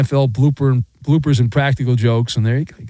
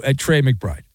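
ordinary delivery

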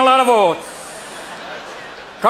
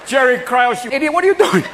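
higher exasperated shout at the manager; mock fan yell; 4 semitones higher than usual. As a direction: yell at the screen. Yelling at team manager.

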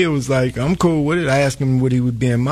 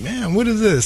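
high-pitched puzzled question; retold bafflement; 9 semitones higher than usual. Telling the host how he puzzled over the hype, baffled. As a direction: recount being lightly baffled, pitched up.

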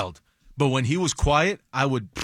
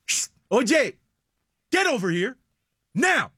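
sharp hush, higher OYE, pause before NOW; higher-pitched GET OVER HERE, pause, then a punched NOW; commanding pauses; 7 semitones higher than usual. Shown as a demonstration. Dad ordering kids over, NOW set apart by a pause. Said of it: "It's the pause in between".

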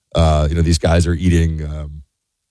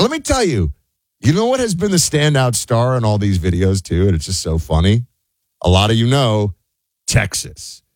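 ordinary delivery

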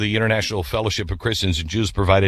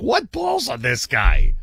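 slow, drawn-out mock disbelief; playful amazed exclamation; mock amazement; 3 semitones higher, slower than usual. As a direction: marvel at his nerve, slowly. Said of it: "you're standing here and you're like".

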